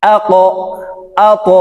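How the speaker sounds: "আ ক" with a rounded, upward bounce; upward qalqala. Shown as a demonstration. Teacher recapping the first two with "আ ক", upward qalqala. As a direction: push the final consonant of "আ ক" upward. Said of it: "উপরের দিকে ধাবিত করে".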